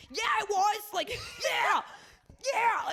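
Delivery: "Yeah, it was!" shrieked high and loud through laughter; excited screaming. Retold or imitated. Friend yelling at the TV documentary, laughing scream. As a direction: scream it out, half laughing, totally worked up. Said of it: "she's screaming in there".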